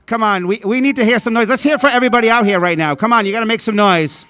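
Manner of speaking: loud hype-man crowd call; rally speech. Acted. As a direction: pump up the crowd, shouting.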